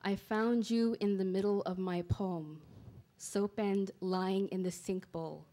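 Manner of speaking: slow, measured poem recital with pauses between images; poetry reading. Acted. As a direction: read each line deliberately, letting it land.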